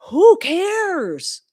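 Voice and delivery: loud, high, drawn-out exclamation; exasperated outburst. Real, not acted. Scolding commenters who attack accents, exasperated outburst. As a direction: throw it out loudly, fed up.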